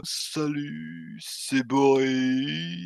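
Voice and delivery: very slow, mellow stoner drawl; laid-back stoned Boris. Retold or imitated. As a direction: drag it out like a stoner. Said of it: "La voix était beaucoup plus".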